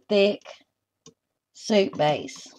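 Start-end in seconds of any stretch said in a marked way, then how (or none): none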